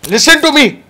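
sudden loud shout. Real, not acted. Yelling at co-host to listen.

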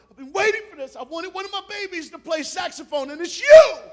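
high-pitched excitement bursting into a shout; overjoyed mom. Retold or imitated. Mom picking her baby to play saxophone, thrilled and loud. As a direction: build to a huge, high "you".

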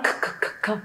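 "khở" in short bursts from the throat; throaty kh. Shown as a demonstration. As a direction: repeat "khở" as a guttural burst. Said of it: "phát ra từ cuống họng của cô". (bật liên tiếp "khở" từ cuống họng)

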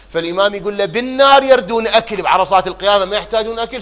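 loud, high impassioned sermon cry; fiery preaching. Acted. Preacher crying out to the mourners about hell, impassioned. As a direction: build the fire of the sermon.

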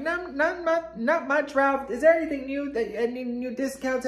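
high-pitched friendly chit-chat; high, sociable small talk; chummy shopper. Retold or imitated. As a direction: gush back in a high, chatty voice. Acting own chatty reply to staff.

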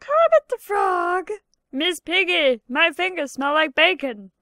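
high, reedy puppet frog voice; Kermit impression. Frog puppet calling Miss Piggy, high reedy voice. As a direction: chirp it like the muppet frog. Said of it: "some of these impressions".

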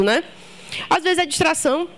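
ordinary talk

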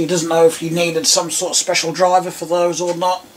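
ordinary delivery

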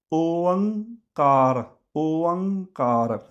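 ਓਅੰਕਾਰ stretched slowly, ਓ gliding into a nasal ਅੰ; teacher's careful slow model of ਓਅੰਕਾਰ; slow model pronunciation. Shown as a demonstration. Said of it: "ਉਚਾਰਨ". (ਓਅੰਕਾਰ ਹੌਲੀ ਹੌਲੀ ਉਚਾਰਨ)

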